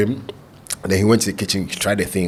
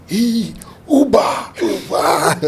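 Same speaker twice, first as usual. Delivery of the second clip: mock customers calling; 11 semitones higher, much slower than usual.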